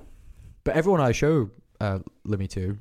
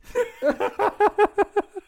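high laugh. Real, not acted. Laughing at own impression.

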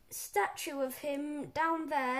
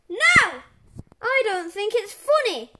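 very loud, raised-pitch refusal; angry yell; 6 semitones higher, 12 dB louder than usual. A narrator's reading. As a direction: yell it as an offended principal. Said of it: "yelled Mister Crump".

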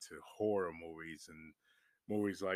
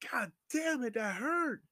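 high, louder cry of pain; acted pained yell; 5 dB louder than usual. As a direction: act out yelling at the hurt. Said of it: "'cause I could yell, like".

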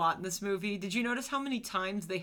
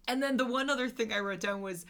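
ordinary talk

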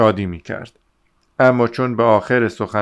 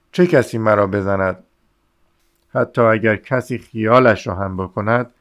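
plain reading voice

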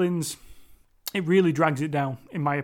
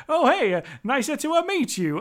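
higher, hammed-up Italian twang; bad Italian accent; 7 semitones higher than usual. Podcaster mocking the Italian actors' English, hammed-up Italian accent. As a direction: overdo the Italian lilt like a cartoon plumber. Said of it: "that Italian twang to them".

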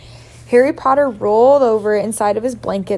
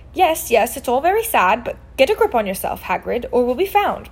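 usual speaking voice

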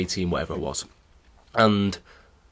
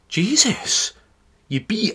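high-pitched, astonished exclamation; amazed outburst, pitched well up; retold amazement; 10 semitones higher, slower than usual. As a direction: react as if stunned by a shock result. Retold amazed reaction to his win.

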